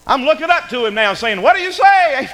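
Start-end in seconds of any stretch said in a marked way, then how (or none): 1.4-2.3 high, loud demand aimed upward; exasperated parent. Acted. Parent still prompting a child who outgrew them, exasperated. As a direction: call it out high, drawing out the end.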